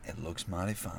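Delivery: softly imitated old Southern accent. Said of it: "she has an old Southern accent".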